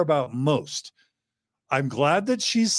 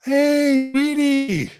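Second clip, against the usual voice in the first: calm and loving; 12 semitones higher, much slower than usual. Acted. Dad welcoming his late teen home, tender and slow. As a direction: greet her gently, like she's precious. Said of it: "I'm gonna be super calm and loving".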